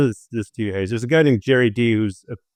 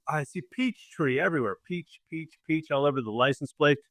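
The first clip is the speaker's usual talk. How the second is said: quiet; 2 semitones higher, 10 dB quieter than usual. Retold or imitated. Retold inner thoughts in Georgia.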